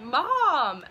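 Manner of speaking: put-on a scared teen calling out "Mom"; retold frightened cry of "Mom.". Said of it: "I'm like".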